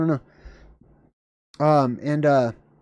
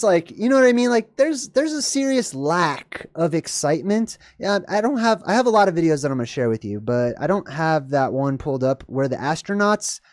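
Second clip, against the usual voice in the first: normal talking voice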